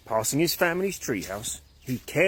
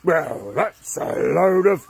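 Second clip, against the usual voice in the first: slow, louder grumpy scoff; 4 semitones higher, 6 dB louder, slower than usual. Acted. Angry caveman scoffing at the old caveman's advice. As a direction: scoff and get cut off.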